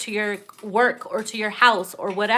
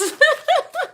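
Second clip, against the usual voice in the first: high laugh; 4 dB louder than usual. Real, not acted. Cracking up.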